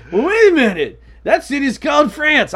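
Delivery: high, loud nitpicking interrupter; mock objector. Imagined listener objecting to A Tale of Two Cities, mock nitpicking. Voices people interrupting his story.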